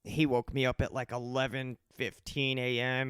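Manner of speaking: ordinary delivery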